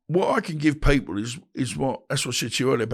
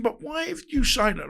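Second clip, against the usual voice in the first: high indignant challenge; indignant question; 12 semitones higher than usual. Retold or imitated. Confronting his friend about the threat, high and indignant. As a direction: demand an answer, pitched up high.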